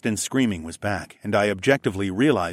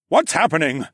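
a sharp, raised demand, higher pitched; councilman's bark; 7 semitones higher, 5 dB louder than usual. Acted. Councilman demanding answers in court, barking. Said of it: "I heard the councilman bark".

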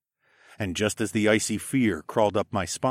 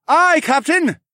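high, loud crew acknowledgement; full-voiced sailor's response; shouting back; 10 dB louder, slower than usual. A narrator's reading. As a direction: shout the reply to the captain. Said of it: "Horace and I both shouted back".

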